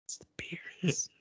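slow, hushed stage whisper; soft, slow whisper; whispered aside. Acted. Whispered aside in Gimli bit.

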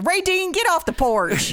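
high, hollering Southern mom. Retold or imitated. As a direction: holler at him off the porch. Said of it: "and you're like".

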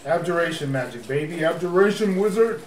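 ordinary delivery

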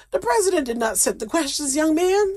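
mock-stern scolding of a student. Real, not acted.

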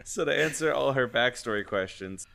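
usual speaking voice

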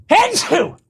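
loud sudden sneeze; real sneeze. Real, not acted. Sneezes mid-sentence.

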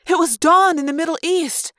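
high, pressing argument; insistent. A narrator's reading. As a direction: insist over the others' panic. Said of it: "Cassie insisted".

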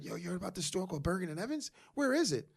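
high, curious mock customer asking around; imagined customer. Owner imitating customers asking where the store is. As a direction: play a customer hunting for the store. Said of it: "'cause I'm like".

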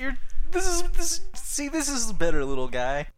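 high condescending praise; patronizing. Retold or imitated. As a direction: pat him on the head with your voice. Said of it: "faintly praises him".

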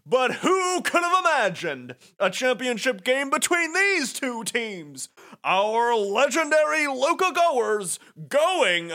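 sports announcer, pitched high and building; Baba. Acted. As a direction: hype up the championship game, drawing out key words. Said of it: "Baba says".

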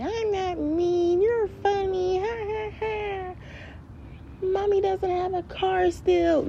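very high, slow kiddie voice; mock child voice. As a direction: squeal it out like a taunting little kid. Voices own child talking back.

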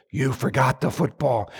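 soft, hushed inner reminder; a gentle voice in his head; quiet inner voice. Retold or imitated. Holy Spirit voice reminding him.

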